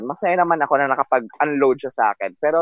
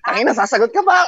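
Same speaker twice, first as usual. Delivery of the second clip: laughing mock shout; faster than usual. Co-host voices angry driver, laughing.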